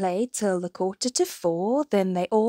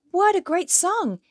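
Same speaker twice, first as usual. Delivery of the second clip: high, excited little bird; tweeting delight; 9 semitones higher than usual. A narrator's reading. Little bird admiring the mouse's song, high and delighted. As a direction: chirp it with lilting, high delight. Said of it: "A little bird tweeted".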